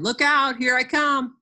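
high, loud warning call. Acted. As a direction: shout it to scattering playmates. Tag player calling out in story.

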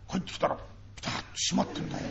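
put-on a child's soft, secretive report; leaning in and dropping the voice low; hushed child telling a secret. Child hushed to father about mother's room.

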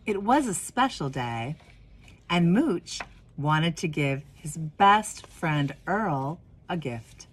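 gentle storybook reading voice; warm, unhurried storyteller; reading to children. Acted. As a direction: read it like a picture book to kids at story time. Reads picture book aloud.

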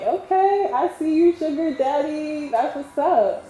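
high-pitched, teasing approval; playfully impressed. Retold or imitated. Reacting to sugar daddy's pricey Uber, playfully impressed. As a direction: hype him up in a high, drawn-out singsong. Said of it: "I was like".

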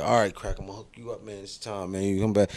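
quietly cutting a deal; hushed offer; low, soft dealer. Retold or imitated. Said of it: "He's like".

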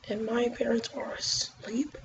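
whispering; hushed whisper, parents asleep. Real, not acted. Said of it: "so sorry I'm talking low like this".

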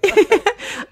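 short high-pitched giggle. Real, not acted. Laughing at own squeal.

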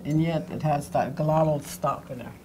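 ordinary talk, nothing marked

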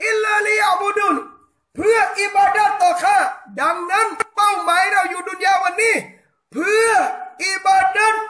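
fervent shouted sermon, hammering each question; preaching at full force. Acted. Preacher rousing the island congregation, fervent shouting sermon.

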